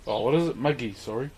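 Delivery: ordinary talk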